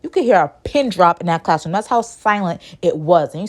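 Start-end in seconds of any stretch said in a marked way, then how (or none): none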